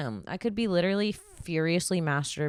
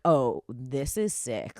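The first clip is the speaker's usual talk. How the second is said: mock creeped-out; 4 semitones lower, much slower than usual. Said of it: "I was like".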